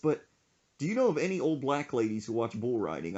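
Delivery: ordinary delivery, nothing marked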